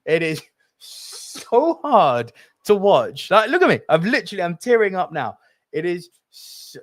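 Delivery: choking up while saying how hard it is to watch; tearing up. Real, not acted. Host tearing up on camera over Bing Bong, voice cracking. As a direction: voice rising and cracking as tears come. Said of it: "I'm getting teared up now".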